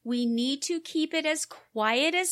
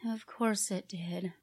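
unvoiced, exasperated whisper; whispered aside; 7 semitones lower, 7 dB quieter than usual. Real, not acted. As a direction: breathe it out under your breath.

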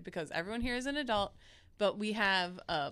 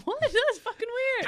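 laughing squeal; 7 dB louder than usual. Real, not acted. Laughing exclamation.

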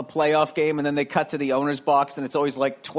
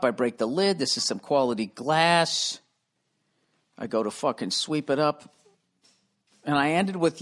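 usual speaking voice

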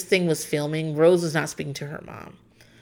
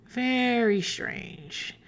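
slow, soft, drawn-out doubt; skeptical aside; 2 semitones higher, 6 dB quieter, much slower than usual. Retold or imitated. Host reacting to Rose's apology, slow and soft skeptical. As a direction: stretch very strange out slowly, quietly unconvinced. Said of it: "And I was like".

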